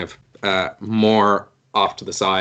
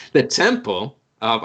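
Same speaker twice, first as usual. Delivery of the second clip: ordinary talk, nothing marked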